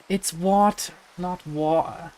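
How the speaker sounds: put-on light British accent on "water", the T said crisp and clear; a hint of a British accent. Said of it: "how did you pronounce water with your British accent".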